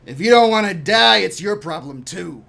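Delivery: loud, high burst easing off; angry retort. Acted. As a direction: snap back with a loud warning. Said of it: "he says".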